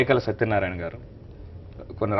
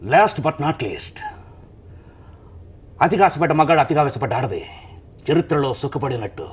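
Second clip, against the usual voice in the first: dramatic film dialogue; 2 semitones higher than usual. Shown as a demonstration. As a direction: deliver it like a film hero, hitting each phrase. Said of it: "చిన్న ముక్క చెప్పండి".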